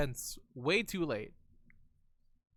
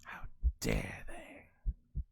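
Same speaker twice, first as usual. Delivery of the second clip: very low, slow, whispered menace; deep whisper; 4 dB quieter, much slower than usual. Acted. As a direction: drag it out in a low rasping whisper. Second take whispered low.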